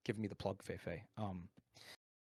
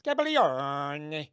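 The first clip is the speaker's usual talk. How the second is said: slow, louder Scabaglione; fake Italian; 5 dB louder, much slower than usual. Shown as a demonstration. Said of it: "repeat after me".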